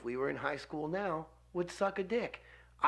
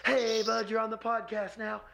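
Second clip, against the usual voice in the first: high, hailing phone-call voice; mock cheery greeting; 6 semitones higher than usual. Host imagining randomly calling a friend, mock cheery. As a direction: pitch it up like greeting a buddy.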